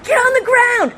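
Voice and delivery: high-pitched police order; yelled command. Retold or imitated. Officer to disarmed burglar, yelled command. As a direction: call it out hard and high. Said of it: "And then I yelled".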